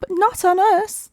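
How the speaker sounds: high squeaky alarmed voices; squeaky oysters. A narrator's reading. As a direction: cry out in tiny panic. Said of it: "the oysters cried".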